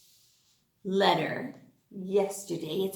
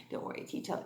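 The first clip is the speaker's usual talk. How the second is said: whispered aside; 13 dB quieter, much faster than usual. Real, not acted.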